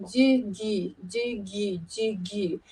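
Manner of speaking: "du, gi" in soft, low, even alternation; tonguing syllable demo. Shown as a demonstration. Oboist showing students a double-tonguing syllable, soft demo. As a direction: say "du, gi" softly as separate tongue strokes. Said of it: "suggest using, um, a syllable".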